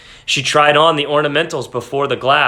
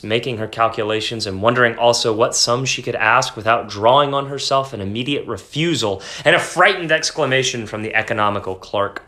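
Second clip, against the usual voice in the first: ordinary delivery, nothing marked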